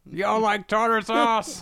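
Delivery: high, drawn-out mock holler; shouting from the rooftops. Old man announcing tartar sauce from the rooftops, shouted high and long. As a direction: yell it slowly to the whole town. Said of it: "I need to go to the rooftops".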